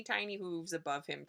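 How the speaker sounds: ordinary delivery, nothing marked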